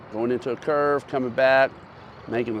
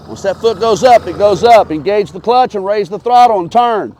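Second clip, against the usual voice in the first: calling out; 6 semitones higher, 8 dB louder than usual. Real, not acted. Coach shouting instructions to rider.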